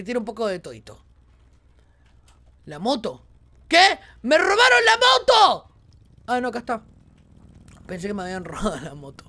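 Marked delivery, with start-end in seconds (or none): 3.7-5.7 high, loud panicked yell; alarmed shout. Real, not acted. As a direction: shock that the bike is gone. Panic about stolen bike. (grito de alarma, agudo y fuerte)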